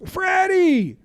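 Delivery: high, stretched mock shout; calling out. Teammates to Freddy in the scuffle, high urgent call. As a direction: call him off across a scuffle.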